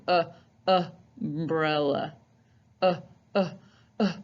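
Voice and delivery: short grunted uh; short U sound. Shown as a demonstration. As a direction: push out a short uh. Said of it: "which has a short U sound".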